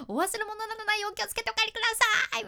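gabbled fast and high; nervous rookie MC rushing the closing line; rushed, stumbling. Retold or imitated. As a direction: rattle it off nervously, tripping on words. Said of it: "ちょっと今のよりももっと噛みながら、まくし立てて".

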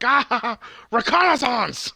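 high-pitched mock battle cry; imitated high yell with a laugh; mock throwing yell. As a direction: cry it out as if hurling something, hammed up. Character throws rock, yelling.

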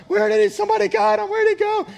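very high, loud, frantic retelling; hammed-up panicked parent, voice shooting up; acted panic. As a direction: cry out in mock alarm. Acts out panicking wife.

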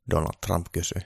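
ordinary delivery

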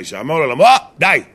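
loud, emphatic cry of the punchline. Real, not acted. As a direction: call it out forcefully, ending high. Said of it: "בלי לצעוק". (הכרזה בצעקה)

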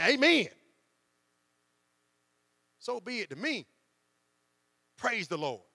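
a spontaneous church exclamation; enthusiastic amen. Retold or imitated. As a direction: praise it out like you mean it. Believer exclaiming at TV.

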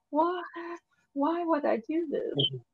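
hushed, slowing bewilderment; baffled self-questioning. Retold or imitated. Asking herself about watching it at work, quietly baffled. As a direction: drop soft, as if puzzling at yourself. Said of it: "I'm like".

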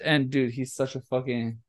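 usual speaking voice

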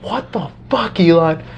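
retelling his own stunned late-night reaction; shocked reaction. Retold or imitated. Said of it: "imagine me watching this".

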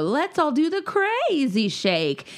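put-on high, slow kids' show voice; cartoon sing-song. Mom quoting a kids' show catchphrase in a high cartoon voice. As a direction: chirp it like a kids' TV host. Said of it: "in Umizoomi where they go".

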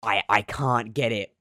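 ordinary talk

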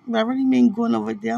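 quick, flustered clarification; rushed. Real, not acted. To Dwight, fixing her joke, quick and flustered. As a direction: hurry to explain yourself.

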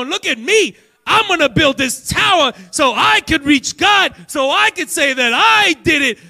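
loud, high preacher shout; preaching at full force. Acted.

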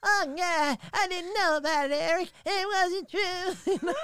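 timid Butters pleading with Eric, high and loud; Butters impression. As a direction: apologise like a nervous cartoon kid. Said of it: "I love doing Butters from South Park".

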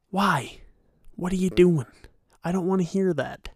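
ordinary talk

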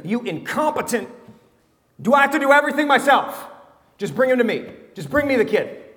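high, loud, fast exasperated rant; a fed-up boss with a useless crew; snapping, do I have to do everything myself; exasperated Jesus. Retold or imitated. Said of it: "That's how he's talking. That's the tone".

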